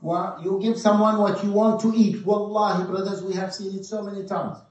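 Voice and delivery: loud, high-pitched preaching; forceful preaching. Acted. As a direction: drive the lesson home to the congregation. Preacher exhorts the brothers.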